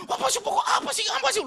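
high, loud, hammed-up scolding; imitated loud grumbling. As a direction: mimic an irritated woman nagging at full volume. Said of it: "dia ngomel-ngomel di dalam itu".

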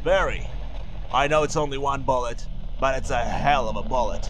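very low, gruff, confident; deep gruff voice. Acted. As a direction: rumble it low and sure.

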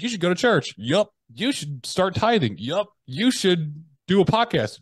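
high, pushy mock-mentor voice, slowing on the advice. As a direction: rattle off orders in a squeaky imitated voice. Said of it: "He's like".

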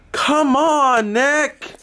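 high, drawn-out complaint; real high-pitched protest; exasperated scolding. Real, not acted. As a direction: call out a friend in exasperation. Scolding friend for farting.

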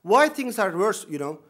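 ordinary talk

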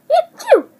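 loud high sneeze; a big hammy sneeze; fake sneeze. Demonstrating sneeze.

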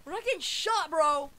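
loud, high protest; urgent yell. Real, not acted. Gamer to bro about getting shot, urgent yell. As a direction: shout it in alarm at a teammate. Exclaiming mid-game under fire.

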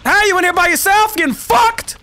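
high, loud put-on mocking voice; mock the enemy in a silly high voice; taunting voice.

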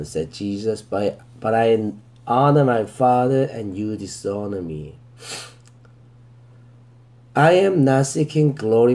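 very slow, deliberate verse reading, word by word; slow scripture reading. Shown as a demonstration. Teacher reading the verse to students, very slow and deliberate. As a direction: read it out slowly so students can follow.

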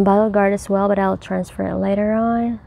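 ordinary delivery

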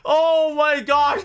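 high, loud, slow cry of disbelief; amazed cry. Real, not acted. Gamer watching the ghast vanish, crying out amazed. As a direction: shout out your astonishment.

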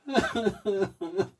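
helpless giggle on camera; giggling fit. Real, not acted. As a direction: burst out in giggles. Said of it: "I'm corpsing".

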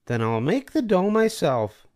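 high hen voice, determined; doing it herself; Little Red Hen. A narrator's reading. Hen deciding to make dough alone, high voice. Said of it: "said the Little Red Hen".

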